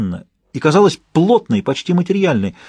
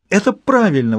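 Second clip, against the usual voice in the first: higher, eager exclamation; exclaimed; 7 semitones higher than usual. A narrator's reading. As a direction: grab at it with hope. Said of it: "воскликнула она".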